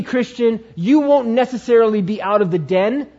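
ordinary delivery

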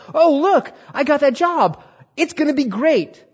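high, loud exclamations of delight; thrilled, pitch leaping way up; excited. Acted. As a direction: crow over great news at a high pitch. Imagines celebrating a new job.

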